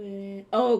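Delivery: usual speaking voice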